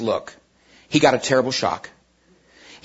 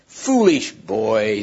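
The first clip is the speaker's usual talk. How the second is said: slow, low scornful witch; 3 semitones lower, much slower than usual. Acted. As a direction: sneer it out slowly like a witch. Witch voiced in a story.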